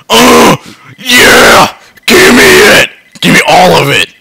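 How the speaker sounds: loud, gleeful yells grabbing loot; triumphant shouting. Real, not acted. Gamer grabbing the loot, gleeful shouting. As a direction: shout with greedy delight.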